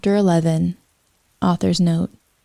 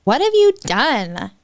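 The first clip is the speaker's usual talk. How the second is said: much higher, wailing wizard voice; crying old wizard; 7 semitones higher than usual. Acted. Dumbledore confronts Ebony, high wailing cry. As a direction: wail it like a sobbing old man. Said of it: "He started to cry wisely".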